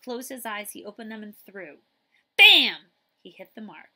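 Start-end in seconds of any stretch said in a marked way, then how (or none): 2.4-2.9 a sudden loud punch on the word; loud bam. A narrator's reading. Narrator bursts out as the ball hits the wall, loud. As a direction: slam it like the ball hitting wood.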